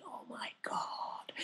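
hushed, slow awed whisper; acted breathless realisation under the breath; whispered amazement. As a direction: whisper it like a thrilling secret. Acts out amazed secret realisation.

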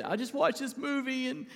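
imitating his own sobbing. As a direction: replay the choked-up husband, lightly. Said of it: "I was just sobbing".

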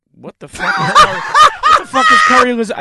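loud laughing disbelief, high; shocked outburst. Retold or imitated. Guest told of curry lasagna, loud incredulous laugh. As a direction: blurt it out in shock.

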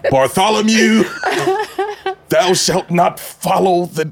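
high, booming scripture voice; mock biblical prophet. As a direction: preach it like an old prophet. Biblical name joke, mock scripture.

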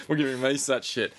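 usual speaking voice

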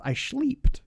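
put-on high, loud German-accented yell. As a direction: blurt it in a thick German accent. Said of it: "but in a German accent".

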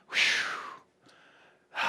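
big blown-out breath, then a sigh; long exhaled whew. Acted. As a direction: blow the air out and sigh before starting. Acting out coming before God.

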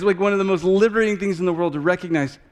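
ordinary talk

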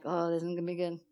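soft, low aside to oneself; uneasy aside. Retold or imitated. Mother alone in her cottage hearing of the wedding, uneasy aside. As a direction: say it under your breath, low. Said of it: "I was like this".

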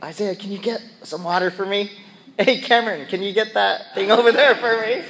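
whiny requests. Retold or imitated. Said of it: "thinks I'm a whiner".

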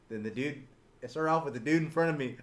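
ordinary talk, nothing marked

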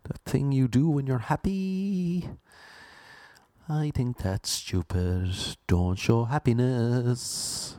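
very slow, drawn-out mock jeer. People mock how someone laughs, slow mocking imitation. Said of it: "people mock someone the way they laugh".

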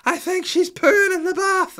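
put-on high, loud, slow wailing little girl; child wailing. Little daughter wails to dad that baby is pooing, high loud child voice. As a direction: wail it like an upset toddler. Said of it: "she was like".

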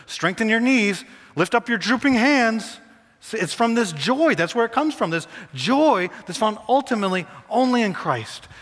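high, driving sermon delivery; impassioned pulpit voice; preaching at full force. Real, not acted. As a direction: preach it hard with rising fervor.

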